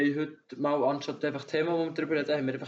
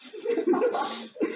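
high burst of laughter. Real, not acted.

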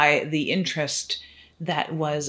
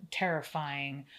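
usual speaking voice

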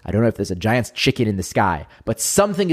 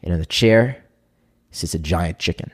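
normal talking voice

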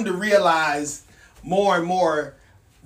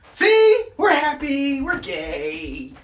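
much higher, louder bright "see, we're happy"; mock cheerful; 5 semitones higher, 3 dB louder, slower than usual. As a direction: ham up the fake joy. Mock cheerful board member.

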